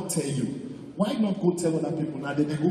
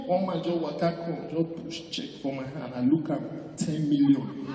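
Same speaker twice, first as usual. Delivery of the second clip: ordinary talk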